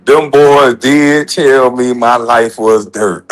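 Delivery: drawn-out, slowed-down punchline. Real, not acted. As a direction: let each word land slowly.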